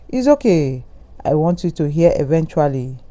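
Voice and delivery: ordinary delivery, nothing marked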